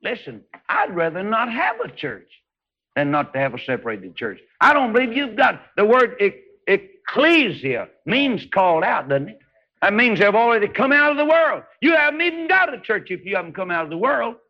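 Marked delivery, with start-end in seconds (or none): 4.6-14.4 high, ringing preacher's cry; full-force revival preaching; fiery preaching. Acted. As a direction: preach it out to the back row. Preaching peak again.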